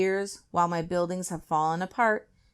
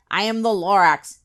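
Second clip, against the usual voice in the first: louder Lorax declaration; coughing announcement; 3 semitones higher, 9 dB louder than usual. A narrator's reading. Lorax announces himself to the Onceler, louder. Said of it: "he coughed and he whiffed".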